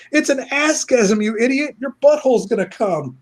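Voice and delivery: high put-on voice scolding; mock exasperation. Sidekick correcting the clueless villain, mock exasperated. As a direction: heckle a clueless fool in a squeaky voice.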